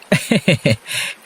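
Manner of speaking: short friendly chuckle at the river; amused laugh. Acted. (বন্ধুর কথায় হালকা হাসি)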